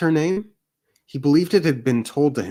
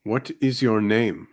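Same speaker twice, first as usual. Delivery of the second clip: terse hunter. Acted. GM voicing Dusk.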